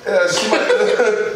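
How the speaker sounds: slow words through laughter; laughing. Real, not acted. Laughing while speaking.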